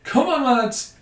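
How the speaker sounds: high, loud rallying shout; excited cheer. Real, not acted. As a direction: cheer the lads on loudly. Cheering goal in game.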